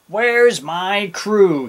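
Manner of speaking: wondering captain. A narrator's reading. Captain awake while Jim sleeps, loudly wondering. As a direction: stretch each word in puzzlement. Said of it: "he wondered".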